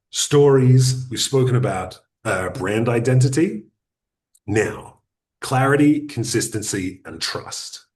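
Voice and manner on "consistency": ordinary talk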